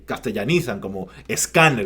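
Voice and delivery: normal talking voice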